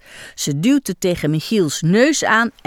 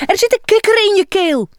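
fast, higher, breathless alarm; alarmed exclamation; 12 semitones higher, 4 dB louder, faster than usual. Acted. Iris tells Michiel there's a frog, fast alarmed blurt. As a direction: blurt the shocking news. Frightened clown reports frog.

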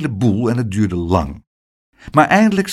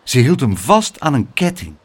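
plain reading voice

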